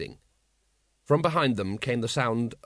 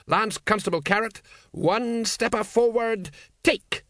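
barking drill order; 9 semitones higher, slower than usual. Acted. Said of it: "Vimes mangling a drill command".

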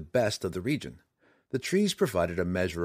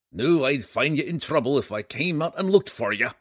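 higher, louder gruff dwarf; gruff gravelly dwarf voice; 6 semitones higher, 5 dB louder than usual. A narrator's reading. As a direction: bark it like a cranky old dwarf. Dwarf Bruenor speaks.

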